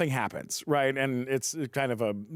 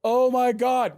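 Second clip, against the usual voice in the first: mock dismay; 8 semitones higher, 5 dB louder than usual. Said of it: "And I'm like".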